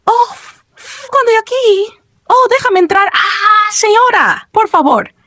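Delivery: high-pitched mock panic; startled fright. A narrator's reading. Player begs a scary lady to let him in, startled fright. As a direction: yelp and plead in a high, frightened voice.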